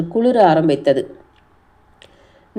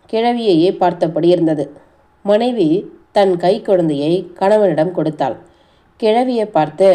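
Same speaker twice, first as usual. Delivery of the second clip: plain reading voice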